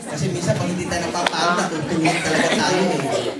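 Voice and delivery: fast, loud laughing aside; teasing laugh about sleepers. Real, not acted. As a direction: joke with the church through laughter. Pastor jokes about sleeping congregation.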